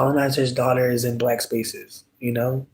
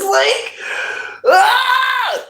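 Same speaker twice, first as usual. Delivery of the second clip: a sudden high frustrated shout, like "ah!"; frustrated yell; 3 dB louder than usual. Real, not acted. Venting about the cop, a sudden exasperated high yell. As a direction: throw out a sharp exasperated cry.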